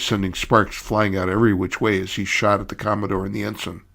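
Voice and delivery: plain reading voice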